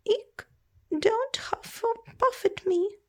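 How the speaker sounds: high, squealing plea; squealing fright. Acted. Small creature begging the baby dragon, high squeal.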